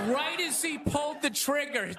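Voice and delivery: ordinary delivery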